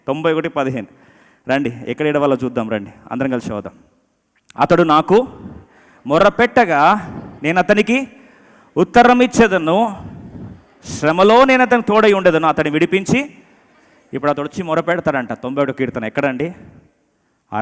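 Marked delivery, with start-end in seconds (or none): 4.6-13.3 loud, high verse reading in separated phrases; scripture proclamation. Real, not acted. Reads scripture aloud forcefully.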